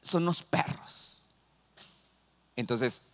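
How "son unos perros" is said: slow, disdainful mock put-down; contemptuous. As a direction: act out looking down on outsiders, dragging the words. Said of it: "así menosprecian".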